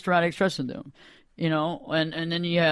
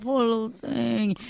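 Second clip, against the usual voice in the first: slow, drawn-out high coo; mock pity; 4 semitones higher, slower than usual. Therapist echoing her co-host's pity, cooing. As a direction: stretch out the sympathy syrupy and high.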